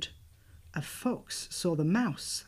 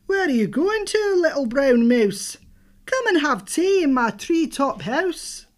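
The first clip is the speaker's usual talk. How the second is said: hooting owl voice; 7 semitones higher, 9 dB louder than usual. Acted. Owl voice in read story.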